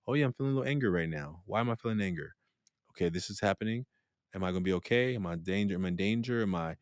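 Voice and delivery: soft, murmured self-check; note the feeling quietly to yourself; inner self-talk. Acted. Meditator noticing their own anger, hushed self-talk.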